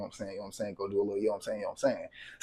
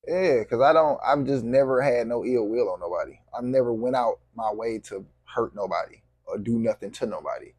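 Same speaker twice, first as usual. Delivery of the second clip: ordinary talk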